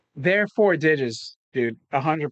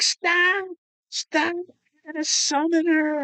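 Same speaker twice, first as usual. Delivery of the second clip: put-on high, slower, frightened child begging; imitating a scared kid, pitched way up; panicked kid pleading; 14 semitones higher, slower than usual. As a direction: play the terrified son stopping his dad. Son begs dad to stop.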